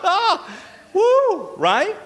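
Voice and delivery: laugh, then a very high loud woo; laughing whoop. Real, not acted. Preacher rousing the congregation, laughing high whoop.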